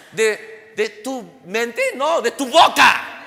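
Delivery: slow, loud shouted answer; preaching shout. Real, not acted. Preacher shouting the answer to his congregation. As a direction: land the answer hard and slow.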